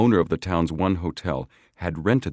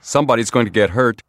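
loud, higher, urgent warning; alarmed exclamation; 6 semitones higher, 9 dB louder, faster than usual. A narrator's reading. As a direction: blurt it out in alarm. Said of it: "Frank Hardy exclaimed".

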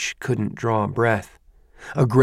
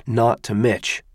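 usual speaking voice

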